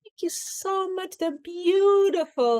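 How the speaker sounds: wife thanking her husband for flowers, high and gushing; gushing thanks. Retold or imitated. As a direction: coo it, overly delighted. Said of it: "He goes".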